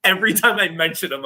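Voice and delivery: ordinary talk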